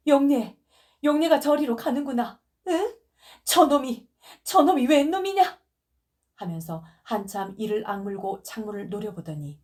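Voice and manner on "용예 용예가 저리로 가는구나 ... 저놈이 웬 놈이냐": high, loud angry wail; furious crying yell. Acted. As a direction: rage at the man taking your daughter. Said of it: "다시 성을 잔뜩 내면서". (높고 크게 울며 분노하는 고함)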